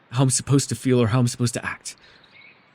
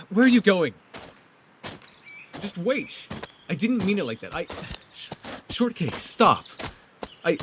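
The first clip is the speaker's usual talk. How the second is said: higher, urgent pleading to stop her leaving; panicked calling after; 7 semitones higher than usual. Acted. Calling after Shortcake as she walks off, panicked plea. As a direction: call out, flustered, don't let her go.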